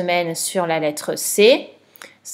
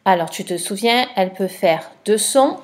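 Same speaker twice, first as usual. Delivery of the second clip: ordinary delivery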